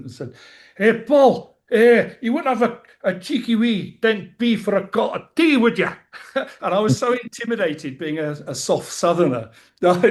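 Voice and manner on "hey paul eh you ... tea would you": high, loud put-on Glaswegian accent; a hard Glasgow street voice, pitched up and loud. A Glasgow stranger begging Paul for money, loud Glaswegian accent. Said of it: "forgive my Scottish or Glaswegian accent".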